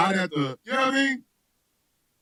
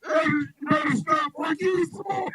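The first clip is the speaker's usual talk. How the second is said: mock choked threat; 7 semitones higher than usual. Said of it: "and I'm like".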